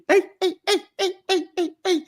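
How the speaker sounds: a squeaky, hammed-up Scouser; Scouse accent. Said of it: "Or you got Scousers in the U K".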